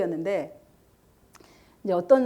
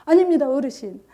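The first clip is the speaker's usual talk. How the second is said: higher and louder, insisting firmly; firm and strong; 4 semitones higher, 8 dB louder than usual. Retold or imitated. Man at the deathbed firmly urging the father, louder and higher. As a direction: plant your feet and refuse the lie. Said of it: "막 강하게 그러더라고요".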